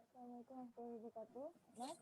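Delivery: very faint, far from the mic; faint voice over a weak connection; barely audible. Real, not acted. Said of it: "mungkin agak dikeraskan suaranya".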